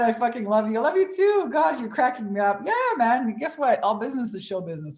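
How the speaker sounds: ordinary delivery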